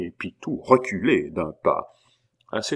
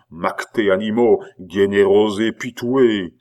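indignant abbé, goading; 3 semitones higher, 7 dB louder than usual. A narrator's reading. Abbé daring Pitou to hang him, goading. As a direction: dare him with mounting declamatory fervour.

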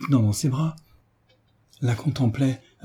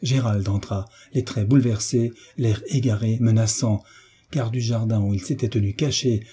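ordinary delivery

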